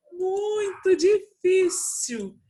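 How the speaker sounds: high, drawn-out, loud lament; half-joking high wail; playful exasperation. Real, not acted. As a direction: stretch it out in a high, comically despairing voice. Apologising for her barking dog.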